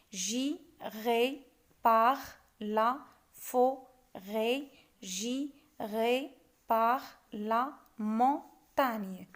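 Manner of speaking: "J'irai par la forêt, j'irai par la montagne" in very slow, separate syllables. Shown as a demonstration. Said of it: "On fait de même".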